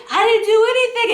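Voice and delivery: fast, high defensive squeal; protesting innocence. Retold or imitated. Accused man to the cousin, protesting innocence. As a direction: imitate him rushing out a high-pitched denial.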